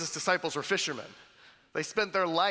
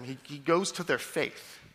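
usual speaking voice

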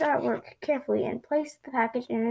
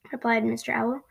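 usual speaking voice

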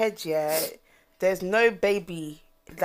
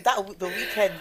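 usual speaking voice

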